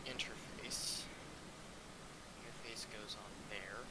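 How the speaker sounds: quiet, half-whispered muttering while working; mumbling to himself. Real, not acted. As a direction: mutter under your breath, barely voiced. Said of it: "I'm just mumbling to myself".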